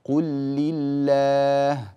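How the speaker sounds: قل لله with one doubled lam, slow and loud; slow demo of قل لله; merged double lam. Shown as a demonstration. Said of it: "بلام واحدة مشددة".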